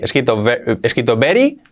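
usual speaking voice

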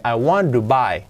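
"want to" linked as "wandu"; soft tapped T. Shown as a demonstration. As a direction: run "I want to buy" together with a tapped t. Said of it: "esa T con una R suave". ("want to", la T como R suave)

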